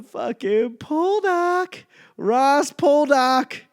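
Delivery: very high, slow, loud announcer-style stretch; grand drawn-out title. Retold or imitated. Hyping the show title like a slow, high trailer voice. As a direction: stretch Ross Poldark out like a movie trailer. Mock-dramatic title declaration.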